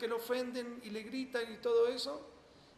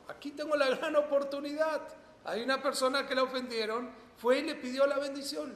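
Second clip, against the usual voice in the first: louder, higher, eager realization; excited; 6 semitones higher, 7 dB louder than usual. Acted. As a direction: she sees her chance, lift it. Mother seizing her big chance. (emoción entusiasta, más fuerte y aguda)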